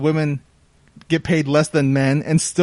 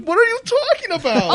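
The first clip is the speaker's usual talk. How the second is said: shrill outraged disbelief, pitched way up. Retold or imitated. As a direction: squeak it out in total disbelief. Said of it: "I'm like".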